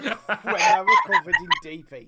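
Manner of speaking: squeaky laughing high. Real, not acted. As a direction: laugh through the words. Laughing mid-story.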